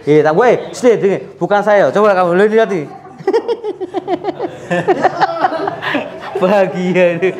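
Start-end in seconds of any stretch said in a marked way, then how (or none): 3.2-6.4 long high breathy laugh. Real, not acted. Healer laughing at the patient's reaction, long and high.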